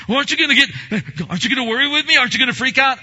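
high, loud, nagging worry; anxious friend. Retold or imitated. Anxious friend pressing the calm one to worry, high and loud. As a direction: pester them to panic with you. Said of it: "strong and firm, yet gentle".

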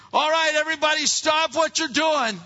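high, loud order to the whole house; acted parental outburst; mad parent yelling. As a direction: halt everyone with an angry yell. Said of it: "'cause I'm getting mad".

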